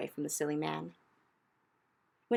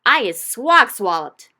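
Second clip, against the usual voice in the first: higher, much louder slow bellow; bellowing giant; 5 semitones higher, 16 dB louder, slower than usual. Acted. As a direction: bellow as a furious captured giant. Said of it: "bellowed the bone cruncher".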